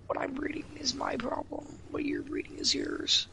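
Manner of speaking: raspy, mostly voiceless tired voice; hoarse whisper. Real, not acted.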